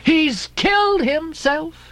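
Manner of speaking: high, slow wail of the landlady; landlady cry. Acted. Mrs Hussey fearing Queequeg is dead, high slow wail. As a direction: wail it out in dismay. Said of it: "she cried".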